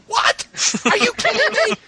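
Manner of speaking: high squeal of disbelief through a laugh; can't believe what you're hearing; incredulous. Retold or imitated. Mocking the characters for co-hosts, laughing disbelief. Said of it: "And they were like".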